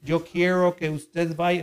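put-on slow, halting Spanish with an American accent; mock a gringo struggling through Spanish. Pastor mimics an American speaking Spanish, slow American accent. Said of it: "como cuando un americano quiere hablar en español".

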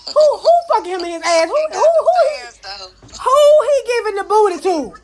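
high, incredulous mock shriek, rising to a laughing squeal; mock outrage. Mocking the rapper in the chat room, mock-outraged shriek. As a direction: act scandalized at a bad rapper. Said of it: "I'm like".

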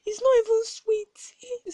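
high-pitched mimicry of a shocked auntie; retold shock. Teasing auntie about hearing her own podcast voice, mock shocked. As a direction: imitate appalled disbelief, voice shooting up and stretching out. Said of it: "She was so shocked when she heard her voice".

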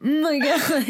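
high-pitched laughter through the words; cracking up, can barely talk; laughing hysterically. Real, not acted. Laughing hard at the drawing.

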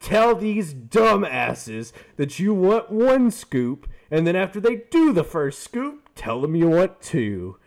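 high, loud, slow put-on video voice; TikTok narrator voice. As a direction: mimic the smug TikTok voice slowly. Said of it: "It goes".

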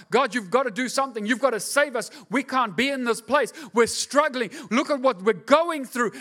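pleading cry. Acted. People crying out to God.

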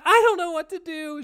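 very high, loud helpless whine; panicked girlfriend. Retold or imitated. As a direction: imitate her frantic panic. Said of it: "And she's like".

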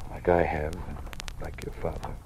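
hushed whisper, barely voiced. Dean whispering a dangerous truth to Anne. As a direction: whisper it so no one overhears.

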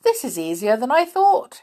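high, loud, gloating giant voice; sly giant. Acted. Cross-eyed giant gloating as the visitors arrive. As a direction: crow over the trapped visitors. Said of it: "he said".

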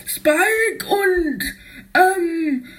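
high, stammering put-on voice; nervous character voice. Spike caught out, high nervous stammer. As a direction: stall and hesitate.

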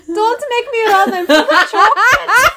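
put-on loud high German kid voice; German accent. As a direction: shout it like the Simpsons German kid. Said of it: "the kid from Simpsons going".

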